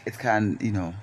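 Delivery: normal talking voice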